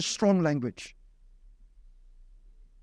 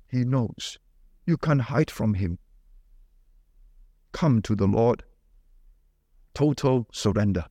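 hushed altar call; 11 semitones lower than usual. Acted. Preacher calls the congregation to surrender, hushed and low.

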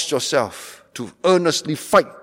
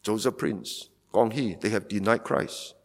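usual speaking voice

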